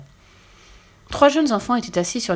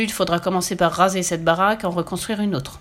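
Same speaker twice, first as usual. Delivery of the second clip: plain reading voice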